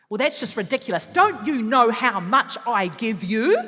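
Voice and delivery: loud, ranting self-righteous parent; acted exasperated telling-off; indignant parent. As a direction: list grievances in rising outrage.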